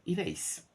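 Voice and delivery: "erase" ending in a sharp s, high. Shown as a demonstration. Teacher showing students the American s sound. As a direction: hiss the s at the end of "erase". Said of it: "U, U ... G A".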